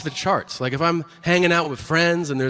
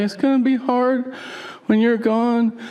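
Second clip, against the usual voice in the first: high, drawn-out weepy imitation; mock crying; 8 semitones higher than usual. Wife to husband leaving for the road, mock weepy. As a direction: wail it like a tearful, clingy wife. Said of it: "she cries when I leave".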